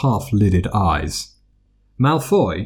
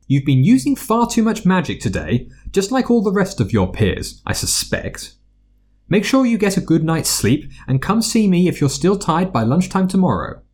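higher, brisk school nurse; brisk matronly healer; 9 semitones higher than usual. A narrator's reading. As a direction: bustle through it like a busy matron. Healer gives advice.

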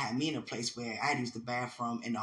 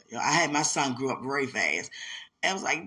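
usual speaking voice

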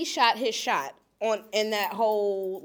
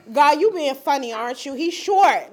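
louder, exasperated plea; mock complaint to God; 5 semitones higher, 8 dB louder than usual. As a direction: complain to the heavens, half-joking.

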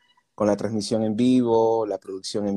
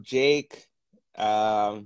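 ordinary talk

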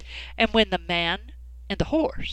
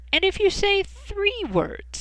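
sly cat; 12 semitones higher than usual. Acted. Cat bargaining slyly.

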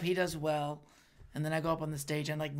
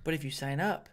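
normal talking voice

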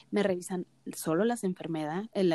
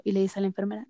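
ordinary talk

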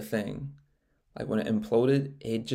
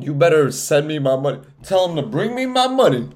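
mock threatening demand; 4 semitones higher, 7 dB louder than usual. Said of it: "Talking about".

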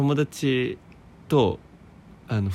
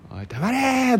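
mock yell; 13 semitones higher than usual. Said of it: "って吠えてたよ".

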